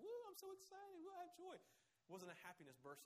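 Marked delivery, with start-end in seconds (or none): 0.0-1.5 high, hammed-up cheering; mock excited whoop. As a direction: squeal like a giddy fan, mocking it. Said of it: "jumping up and down, going".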